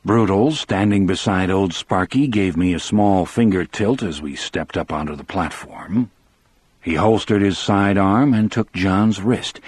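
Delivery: ordinary delivery, nothing marked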